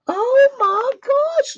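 very high, slow, drawn-out gasp of delight; high delighted squeal. Real, not acted. Crafter seeing the gift bag, squealing in delight. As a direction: let the joy spill out in a squeal.

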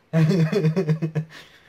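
bursting out laughing; a big real laugh; loud laugh. Real, not acted. Reaction video, big laugh.

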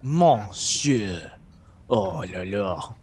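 slow, hammed-up French accent. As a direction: stretch it like a cartoon Frenchman. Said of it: "my outrageous French person accent".

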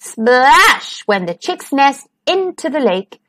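usual speaking voice

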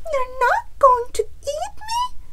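very high, loud little hen voice; anxious chicken. A narrator's reading. Chicken questions Bear after the chase, high anxious squeak. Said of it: "Chicken asked".

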